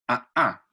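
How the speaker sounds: A, a con golpe de glotis; golpe glótico. Shown as a demonstration. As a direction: marca las a con un golpe de garganta. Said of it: "se llama golpe glótico". (A, a con golpe glótico)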